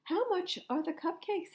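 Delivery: put-on a boy's voice pitched well up; high boy voice. A narrator's reading. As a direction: ask it like an eager little boy. Norman voiced in read story.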